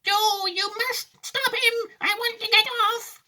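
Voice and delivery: very high, drawn-out granny cry; panicked yell. A narrator's reading. Grandma begging husband to stop Wonka, high yell. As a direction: yell for help, pitched right up. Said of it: "yelled Grandma Josephine".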